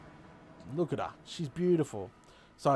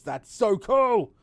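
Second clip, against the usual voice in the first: excited; 6 semitones higher, 7 dB louder, much slower than usual. Real, not acted. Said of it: "repeats delight".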